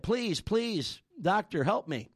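high, urgent begging; retold desperate plea. Man in the hole begs the passing doctor, urgent plea. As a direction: call up to the doctor for rescue.

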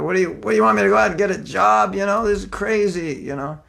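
a preacher venting to Krishna, exasperated; exasperated complaint. Retold or imitated.

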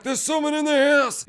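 high, urgent acted cry; alarmed shout. Soldiers run back after knocking sound, alarmed shout.

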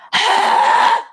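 high raspy scream burst; loud throat scream. Shown as a demonstration. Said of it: "tighten your throat and then you use your diaphragm".

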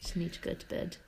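soft, breathy, unvoiced; real sleepy whisper; tired whisper. Real, not acted.

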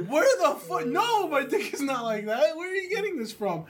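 high, acted outraged protest; indignant disbelief. Retold denial of the rumour.

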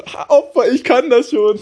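put-on high, cocky gloating kid; mocking first-grader. Kid taunting confused classmates, high and cocky.

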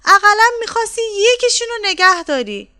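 high, loud wail of reproach; shouted storybook scolding, loud and high; wife's shout. A narrator's reading. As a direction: cry out at your husband, pitched high. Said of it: "و داد زنه رفت هوا که".

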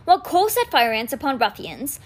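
ordinary delivery

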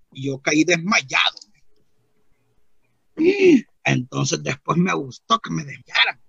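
normal talking voice

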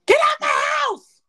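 high, loud yell to get out; shouting. Pretend. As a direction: throw them out with a shriek. Acting out kicking a date out.

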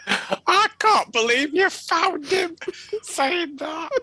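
high-pitched, slow, laughing disbelief; helpless laughter. Real, not acted. Host amazed the co-host found that clip, helpless laughter. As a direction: squeal it through real laughter.

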